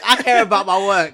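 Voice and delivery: laughing. Pretend. Mocking her friend, laughing.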